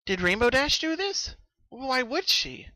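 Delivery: wondering if her friend did it; Pinkie's voice. Acted. Pinkie puzzling whether Rainbow Dash caused the fog, high and slow.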